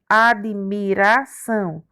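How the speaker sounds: slow, measured syllable division; syllables separated. Shown as a demonstration. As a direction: sound out the word for pupils. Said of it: "O D ficou na primeira sílaba". (devagar, dividindo as sílabas)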